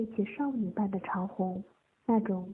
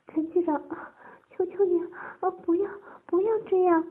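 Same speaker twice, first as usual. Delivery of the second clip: high-pitched pleading broken by gasps; 9 semitones higher, 4 dB louder than usual. A narrator's reading. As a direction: beg anxiously in a high voice. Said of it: "柔声细语地哀求着男人".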